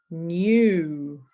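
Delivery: New said with a long oo vowel; clear new. Shown as a demonstration. Teacher modelling a minimal pair, New with a long oo. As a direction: round the lips for the oo in New. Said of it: "listen carefully and say for yourself".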